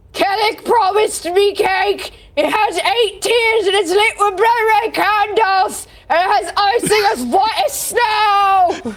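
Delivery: slow, high bellowing giant voice; giant's demanding bellow. Acted. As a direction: roar it out like a greedy giant.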